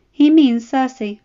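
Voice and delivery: lightly voiced woman, matter-of-fact; Ella knowing. A narrator's reading. Ella cutting in.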